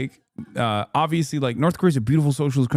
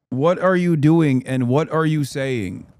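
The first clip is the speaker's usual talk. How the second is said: normal talking voice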